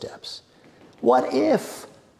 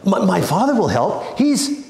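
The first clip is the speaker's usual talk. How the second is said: higher and louder, stumbling on the words; flustered panic; 9 semitones higher, 5 dB louder than usual. A narrator's reading. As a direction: blurt it out in alarm. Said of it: "Ida gasped".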